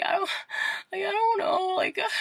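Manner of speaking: high tearful wail; mock crying. Telling brother about breaking down, mock crying. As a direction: act out breaking down in sobs.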